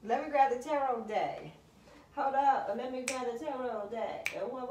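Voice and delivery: excited shout to the viewers. Real, not acted. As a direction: rush for the deck, thrilled. Excited mid-reading.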